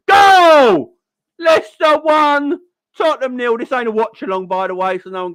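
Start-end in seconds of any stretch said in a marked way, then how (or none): 0.1-3.6 shouted goal call, much higher and louder; live commentary. Pretend. Streamer mock-calls the Leicester goal for viewers, shouted commentary. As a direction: bellow the goal like a match commentator. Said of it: "Live commentary".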